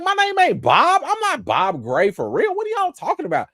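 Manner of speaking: very high, squeaky indignant clown; indignant Pennywise. Retold or imitated. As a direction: shriek it like an insulted clown. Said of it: "Pennywise, like".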